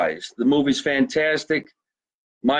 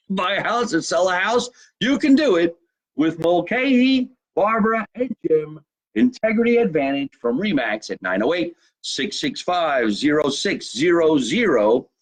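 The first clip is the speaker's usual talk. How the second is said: high-pitched pitchman patter; sponsor read; 4 semitones higher than usual. Acted. Host plugs realtors to buyers and sellers, announcer read. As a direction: pitch it like an announcer, drawing out the phone number.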